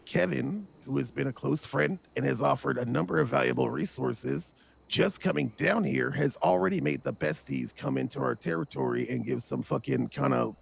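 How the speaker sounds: ordinary talk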